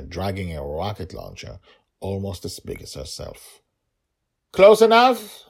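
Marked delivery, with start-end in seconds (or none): 4.5-5.4 high, loud yell across the distance. A narrator's reading. Little dragon girl yells back to her hiding father, loud high call. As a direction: call it out to the forest. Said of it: "she yelled back".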